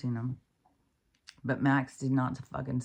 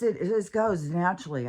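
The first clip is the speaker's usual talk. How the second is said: ordinary talk, nothing marked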